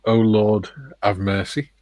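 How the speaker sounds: usual speaking voice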